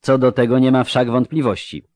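ordinary delivery

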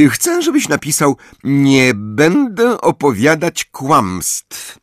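high, slow, sugary dictation; sweetly dictating a cruel line; gently. A narrator's reading. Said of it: "oznajmiła łagodnie".

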